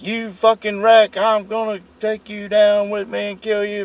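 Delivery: high, loud mock snarl at him; drunk girlfriend's threat. Mocking the girlfriend who choked him on the bike, high snarl. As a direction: spit the threat like she's out of control. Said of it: "She's like".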